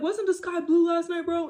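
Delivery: high, loud, laughing bro; hammed-up buddy asking for backup; mock bro voice. Boyfriend fishing for his friend's backup, laughing mock bro. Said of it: "He could be like".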